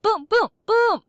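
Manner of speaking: loud, high, drawn-out booms; manic sarcastic. Pretend. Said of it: "In an overly sarcastic, slightly manic voice".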